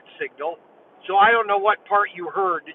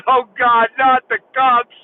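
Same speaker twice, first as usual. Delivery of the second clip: a higher, louder retold whine over the handcuffs; kid's panic; 4 semitones higher, 6 dB louder, slower than usual. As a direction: play the caught kid begging, half-mocked. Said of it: "He goes".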